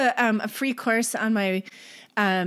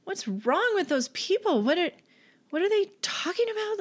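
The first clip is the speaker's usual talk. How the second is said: put-on higher voice of a small child asking grandma; curious child; 9 semitones higher than usual. Small child asking grandma in the grocery line, high and curious. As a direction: ask like a puzzled four-year-old, rising higher. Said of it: "the child's just like".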